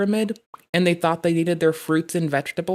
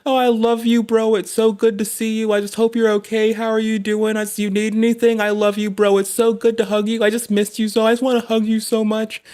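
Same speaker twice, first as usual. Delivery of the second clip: higher and louder, a tearful hugging bro; mock-tearful bro hugging his friend, pitched up and loud; gushing crying bro; 5 semitones higher, 4 dB louder than usual. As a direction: gush at a long-lost buddy, a bit faster. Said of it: "hug you and start crying and being like".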